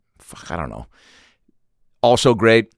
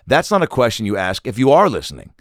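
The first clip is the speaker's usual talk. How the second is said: normal talking voice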